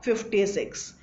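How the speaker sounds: ordinary delivery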